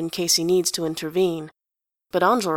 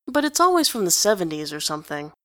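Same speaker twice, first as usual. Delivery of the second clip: usual speaking voice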